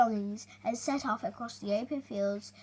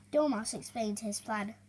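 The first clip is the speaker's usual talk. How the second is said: ordinary delivery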